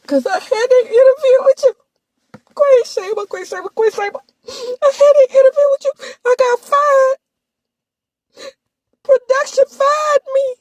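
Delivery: high, loud fake-tearful whine; hammed-up blubbering complaint; mock crying. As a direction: imitate him wailing his excuses. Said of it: "can come with the tears".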